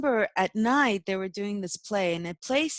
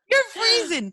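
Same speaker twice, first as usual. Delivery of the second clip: high, loud laughter; laughing; 8 dB louder than usual. Real, not acted. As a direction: crack up at the freeze. Laughing, video frozen.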